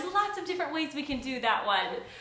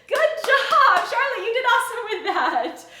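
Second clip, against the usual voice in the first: high, loud, laughing praise; excited praise; 9 semitones higher, 9 dB louder than usual. Real, not acted. Teacher praising Charlotte after the song, laughing.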